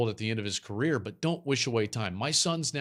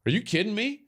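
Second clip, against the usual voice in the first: usual speaking voice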